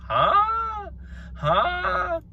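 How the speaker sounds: very high, squeaky "huh"; rising yelp of bafflement; incredulous. Acted. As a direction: squeal it in mock disbelief. Incredulous at bunk beds.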